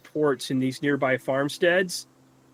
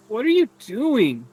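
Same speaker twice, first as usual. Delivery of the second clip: startled; 7 semitones higher than usual. Acted. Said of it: "He's like".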